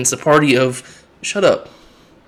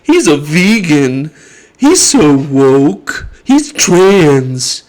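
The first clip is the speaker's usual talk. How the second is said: louder, slow sneering mock; mock attack-ad voice; 6 dB louder, much slower than usual. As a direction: drag the words out with scorn. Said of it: "and saying".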